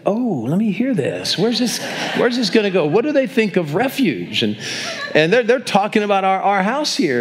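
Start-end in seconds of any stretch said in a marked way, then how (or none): 0.0-4.4 curious eavesdropping. Retold or imitated. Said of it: "I said".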